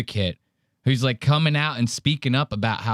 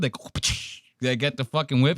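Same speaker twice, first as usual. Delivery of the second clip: usual speaking voice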